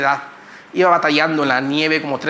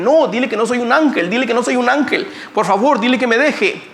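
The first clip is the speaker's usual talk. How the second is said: urgent protest; 7 semitones higher than usual. Retold or imitated. Retold plea to the translator, urgent and higher. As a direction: beg the translator to stop her. Pleading with translator, refusing praise.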